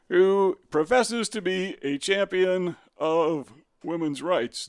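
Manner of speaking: professes leaned on, the whole phrase slowed; slow down and press hard on professes to sound doubtful; sarcastic weight on professes, drawn out; stress on professes. Shown as a demonstration. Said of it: "Notice the emphasis on the word profess".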